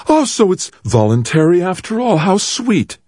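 high, bitter mocking mother; bitter sarcasm. A narrator's reading. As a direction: spit the sweetness back as scorn. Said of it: "weeping turned to bitter laughter".

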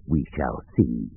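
low, soft, slow villain; slow, hushed dark lord's farewell; ominous parting words. Acted. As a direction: let it fade as a dark threat. Vanishing villain's last words.

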